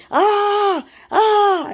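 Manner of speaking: high mock scream, held out; drawn-out "ah" in a put-on high holler; imitated hollering. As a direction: mimic someone hollering in high-pitched wails. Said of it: "and hollering".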